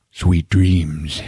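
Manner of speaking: understated low one-liner; cool action-hero sign-off. Retold or imitated. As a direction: deliver it like a hero's kill line. Hero's deadpan line before shooting.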